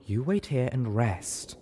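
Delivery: slow, lightly voiced bossy mare; a hint of a prim nurse giving orders, drawn out slowly; gentle bossiness; ordering. A narrator's reading. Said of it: "Velvet ordered the zebra mare".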